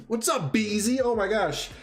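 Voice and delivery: ordinary talk, nothing marked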